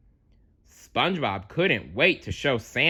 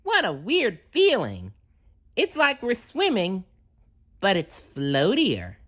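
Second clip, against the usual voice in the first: much higher, wondering cartoon sponge; SpongeBob voice; 10 semitones higher than usual. A narrator's reading. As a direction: marvel at floating, pitched up. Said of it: "exclaimed SpongeBob".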